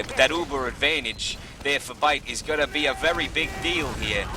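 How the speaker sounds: ordinary talk, nothing marked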